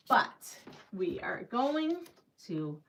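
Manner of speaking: slow, hushed tease before a reveal; suspenseful build-up. Pretend. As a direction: draw it out softly for suspense. Teasing the reveal to viewers.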